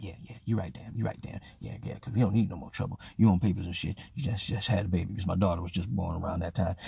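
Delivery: low, soft, backing down; imitated subdued agreement. Kato admitting his friend is right, low subdued voice. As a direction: concede quietly as the calmed-down friend.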